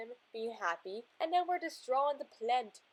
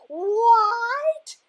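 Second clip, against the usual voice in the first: much higher, loud shocked yelp; shocked what; 11 semitones higher, 13 dB louder than usual. Acted. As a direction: blurt it out as the startled little monster. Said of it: "And he's like".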